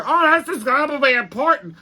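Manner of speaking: high, put-on spectator voice; impressed onlookers. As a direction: imitate gawking fans in mock awe. Imitates onlookers in the stands.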